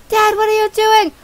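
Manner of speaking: put-on high, loud kid yelling at Dad; alarmed son voice. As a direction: shout at your reckless father as a child. Son alarmed at dad's driving.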